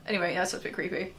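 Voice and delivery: ordinary talk, nothing marked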